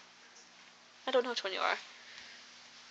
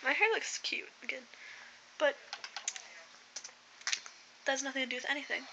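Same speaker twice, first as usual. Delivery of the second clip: ordinary talk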